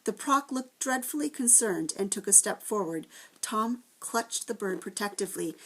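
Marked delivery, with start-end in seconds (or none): none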